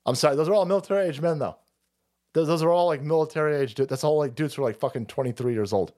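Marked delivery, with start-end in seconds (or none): none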